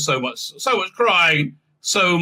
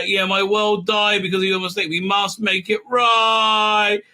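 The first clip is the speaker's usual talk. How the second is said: overacted vow, rising higher; mock impassioned cry; 4 semitones higher than usual. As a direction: build to a hammed-up outcry. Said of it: "cries Birdman".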